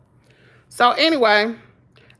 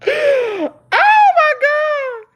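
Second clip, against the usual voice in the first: high, loud outburst; loud shocked exclamation; exclaiming; 13 semitones higher, 4 dB louder, much slower than usual. Real, not acted. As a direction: throw it out in disbelief. Exclaiming at the story.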